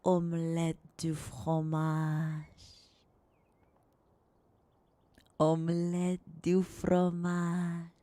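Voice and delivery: slow, drawn-out French accent; hammed-up soft French accent voice. Shown as a demonstration. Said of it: "in a soft French accent voice".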